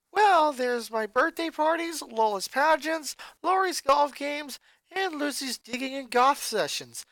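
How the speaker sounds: chatty and cheerful, a bit puzzled; high bubbly joker sister; comedian sister. A narrator's reading.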